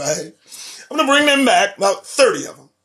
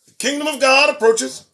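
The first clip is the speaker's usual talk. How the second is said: "The kingdom of God approaches" higher and louder, rising on "God"; ringing proclamation; 7 semitones higher, 3 dB louder than usual. Real, not acted. Preacher warning the congregation, ringing and raised.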